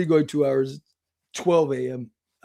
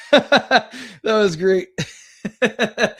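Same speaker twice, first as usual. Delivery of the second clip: laughing through the words; real amused laughter; 4 semitones higher, 5 dB louder, much slower than usual. Real, not acted. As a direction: crack up, slowing as you laugh. Cracks up at troll comment.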